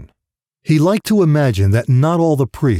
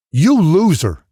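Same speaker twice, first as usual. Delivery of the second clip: angry father; 5 semitones higher, slower than usual. Acted. Father berating his son.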